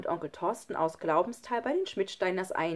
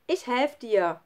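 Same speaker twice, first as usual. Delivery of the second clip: slightly raised, higher call; 5 semitones higher, 4 dB louder, slower than usual. A narrator's reading. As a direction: call encouragement down to a friend. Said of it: "rief Schlunz". (ermutigend gerufen)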